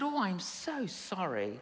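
understated sympathy; soft mock sympathy. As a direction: answer gently, almost teasing. Preacher's mock-sympathetic reply.

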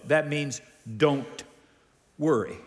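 slow "don't", pause, then "worry"; deliberately slow. Shown as a demonstration. Preacher drives home a scripture's meaning, deliberately slow. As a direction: spell it out slowly, "don't" ... "worry". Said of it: "I'm gonna say that slow".

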